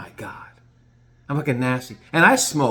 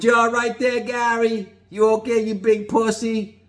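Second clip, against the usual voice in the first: mocking Gino; 8 semitones higher than usual. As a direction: needle the seasick friend with fake concern. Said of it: "Gino goes".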